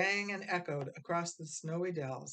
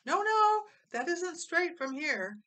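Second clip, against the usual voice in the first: much higher, louder scolding; bossing her brothers about the tree; scolding big sister; 13 semitones higher, 7 dB louder than usual. A narrator's reading. Ada bossing the boys with the tree, a high loud scold. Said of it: "Ada scolded".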